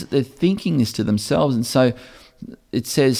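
usual speaking voice

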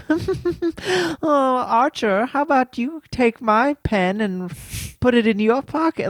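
over-the-top posh mimicry; hammed-up posh rich-person accent; weirdly posh. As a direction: play it absurdly posh, like a sketch. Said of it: "They're, like, weirdly posh".

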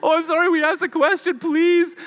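high-pitched, hammed-up grovelling apology; mock apologetic pleading. People imagined begging the king for forgiveness, mock pleading. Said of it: "He expects them all to be like".

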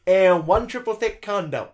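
high, slow, fussy list voice; hammed-up packing check; mock fussy checklist. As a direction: tick off each item like a prim nanny. Imitating fussy companion checking kit.

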